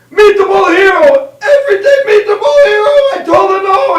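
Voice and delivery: hammed-up wailing Italian shout, very high; Italian man at breaking point. Italian worker finds meatball hero before jumping, anguished high shout. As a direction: wail in comic Italian despair. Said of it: "Then the Italian man opens up".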